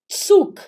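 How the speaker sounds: Zug begun with a sharp ts, "tsuk". Shown as a demonstration. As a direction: hit Zug high and loud with ts. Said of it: "ele tem som de T S". (Zug com som de TS, "tsuk")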